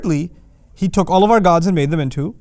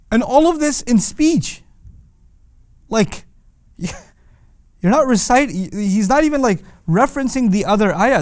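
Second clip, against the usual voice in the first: amazed; 5 semitones higher than usual. Real, not acted. Marvelling at Quran's consistency.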